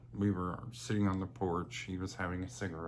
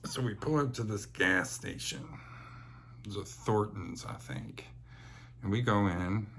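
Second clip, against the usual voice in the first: ordinary talk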